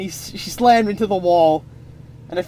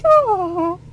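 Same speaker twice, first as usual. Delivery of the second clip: much higher dreamy oh; swooning sigh; 11 semitones higher than usual. Acted. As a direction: sigh like a smitten lady. Abby swoons.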